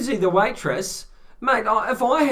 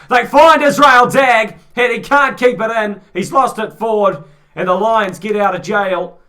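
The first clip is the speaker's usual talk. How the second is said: excited; 4 semitones higher, 11 dB louder than usual. Real, not acted. Near-try in live commentary.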